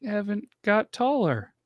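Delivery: high, slow sing-song auntie voice; mock aunt greeting a grown nephew at a reunion; mock relative. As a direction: coo it like a doting old aunt. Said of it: "how many people are gonna go".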